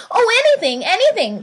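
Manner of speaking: high, loud and eager; eager cry. A narrator's reading. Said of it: "cried the little soul".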